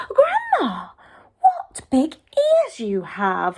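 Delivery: put-on high breathy amazed child; wide-eyed girl. Red staring at the ears, high and amazed. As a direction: gasp it like a startled little girl.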